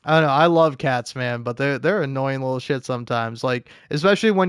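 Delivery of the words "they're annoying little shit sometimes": ordinary talk, nothing marked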